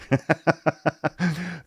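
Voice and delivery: high chuckle at oneself; amused laugh. Real, not acted. Host tripping over the word courage, amused laugh. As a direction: laugh off your own slip.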